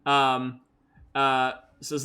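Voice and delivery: ordinary talk, nothing marked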